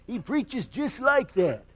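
put-on high, quavering old country preacher; Pastor Pyle. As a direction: impersonate an old-movie pastor with a warbling voice. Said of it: "he talks like this".

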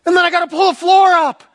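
high, loud, flustered stammering; frantic panic. Retold or imitated. Husband in his underwear explains the flood to his wife, frantic. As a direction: blurt it out in a high-pitched panic. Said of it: "I said".

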